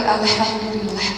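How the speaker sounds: hushed grateful murmur, voice held steady; inner thanks. Retold or imitated. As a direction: say it as if only in your heart. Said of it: "Namun dalam hati".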